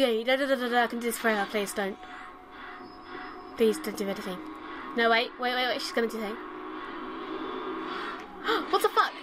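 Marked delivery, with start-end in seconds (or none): 6.4-8.7 panicked gasp. Real, not acted. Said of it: "I panicked".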